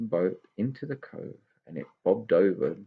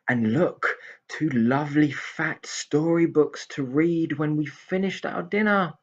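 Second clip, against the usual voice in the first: higher, slow happy pixie voice; delighted pixie; 7 semitones higher than usual. Acted. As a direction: marvel at the storybooks.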